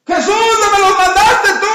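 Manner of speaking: high, loud shouted question; calling out. Acted. Bartimeo checks with Jesús over the crowd, shouting. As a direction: yell the question across the crowd.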